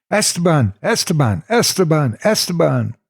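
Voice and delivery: high panicked repeated cry; trapped in his own name, screaming it; screaming repeatedly. A narrator's reading. Boy stuck repeating his name, high panicked screaming. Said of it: "kept screaming the word on repeat".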